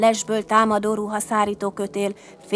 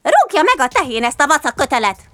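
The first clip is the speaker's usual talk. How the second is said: high, loud cross outburst; higher-pitched, irritated cursing; angry huffing cat; 6 semitones higher, 7 dB louder than usual. A narrator's reading. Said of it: "s így fújtatott mérgesen".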